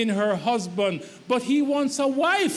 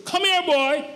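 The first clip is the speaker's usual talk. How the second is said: put-on higher, slightly louder order to a boy; mother's order; 6 semitones higher, 3 dB louder than usual. Husband hears his wife as his mother, bossy order. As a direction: call him like a mother summoning her son. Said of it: "what he hears is".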